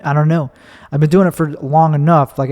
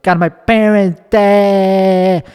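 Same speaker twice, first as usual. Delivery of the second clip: put-on high, slow, stretched Chicago accent; exaggerated Chicago accent; 5 semitones higher, 3 dB louder, much slower than usual. Comic replaying the answer that got a laugh, hammed-up Chicago accent. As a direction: overdo the Chicago accent, dragging out the final vowel. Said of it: "I could have purposely toned it down".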